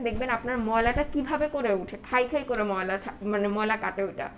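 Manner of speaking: ordinary talk, nothing marked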